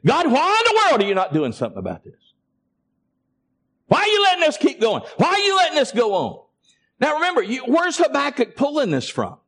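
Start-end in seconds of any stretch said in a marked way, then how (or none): 0.0-6.5 high, fast, exasperated questioning of God; exasperated plea. Acted. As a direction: demand answers from God, pitch climbing. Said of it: "you want it just in plain language".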